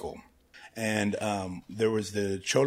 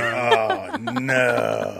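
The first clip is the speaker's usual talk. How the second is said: mock dread; 8 dB louder than usual. Friends react in dread.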